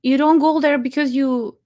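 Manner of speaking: ordinary delivery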